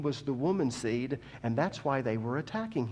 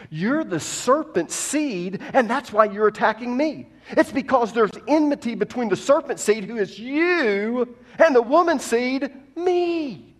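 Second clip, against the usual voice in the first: higher and louder, building to a cry; from firm to near shouting; rising indignation; 9 semitones higher, 9 dB louder than usual. Acted. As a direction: voice Jesus turning the accusation back. Preacher voicing Jesus, rising.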